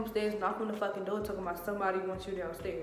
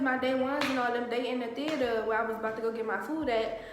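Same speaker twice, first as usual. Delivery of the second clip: usual speaking voice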